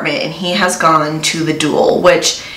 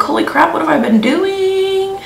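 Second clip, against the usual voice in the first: much higher, squeaky exclamation; startled realization; 11 semitones higher than usual. Real, not acted. Vlogger notices her makeup is still unfinished, shocked and high. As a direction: caught off guard, voice flying up.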